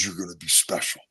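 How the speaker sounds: soft, low imitation of a coach; hushed retold encouragement; close in the ear. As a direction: lean in and speak low, privately. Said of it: "his mouth gets right next to my ear".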